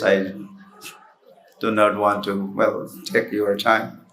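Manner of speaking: ordinary delivery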